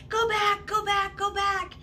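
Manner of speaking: high repeated warning yells; retold yell. Boys on boogie boards yelling at the girls, high yelled warning. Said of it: "I hear them screaming".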